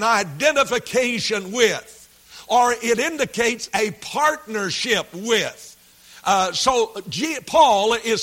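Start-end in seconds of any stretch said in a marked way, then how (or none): none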